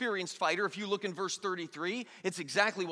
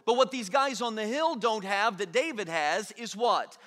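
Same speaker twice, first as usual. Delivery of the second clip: usual speaking voice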